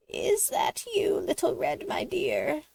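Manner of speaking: put-on high old granny voice calling through the door. A narrator's reading. Old Granny calling to the knock at her door, high voice. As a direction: call out sweetly like an old granny. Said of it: "called Granny".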